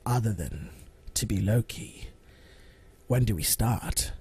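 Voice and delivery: low British villain, long pauses; measured villain in a British accent; deep, deliberate British menace; Tom Hiddleston. Acted. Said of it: "My villain is British is Tom Hiddleston".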